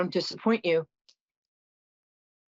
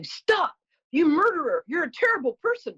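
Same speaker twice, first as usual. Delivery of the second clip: high, mock yell of an angry stranger; retold yell; 9 semitones higher than usual. Retelling the subway stranger's accusation, high mock yell. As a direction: shout it as the accuser, half-acted. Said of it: "somebody behind me was yelling".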